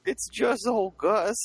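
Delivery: put-on high slow old-timey miner drawl; hammed-up cowboy prospector voice. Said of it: "old-timey prospectors".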